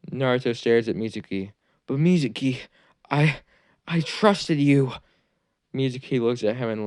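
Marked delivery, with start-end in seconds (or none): 1.9-4.9 put-on hurt, betrayed boy. Naruto tells his betrayer he trusted him, hurt plea. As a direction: let the trust break in the voice.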